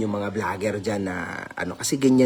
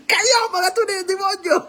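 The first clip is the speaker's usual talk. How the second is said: much higher, hammed-up dramatic line; hammed-up, doing it better. Shown as a demonstration. Vlogger redoing his line for a commenter, hammed-up. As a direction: push it over the top as asked. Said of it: "O, yan, ginalingan ko".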